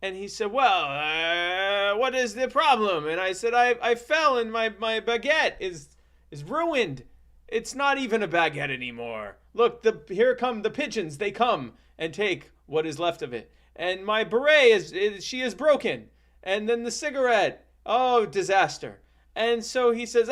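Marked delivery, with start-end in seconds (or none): 0.5-3.1 high, drawn-out comic Frenchman; hammed-up French rescuer; Jacques de Gatineau. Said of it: "that's how he talked". 3.5-19.0 lightly voiced high, distressed kid; mock-tragic lament in a raised pitch; a hint of hammed-up childish woe; lamenting child, acted. Acts child lamenting ruined things.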